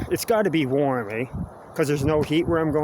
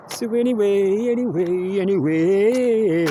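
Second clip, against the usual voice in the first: higher, louder drawn-out anyway; playful singsong; 5 semitones higher, 4 dB louder, slower than usual. Pretend. Vlogger changing subject with a hammy singsong. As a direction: stretch the last anyway loud and slow.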